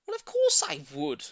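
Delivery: very high, loud exclamation; cried out. A narrator's reading. Old lady exclaiming to neighbour, very high cry. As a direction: burst out with it. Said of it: "Missus Silver cried".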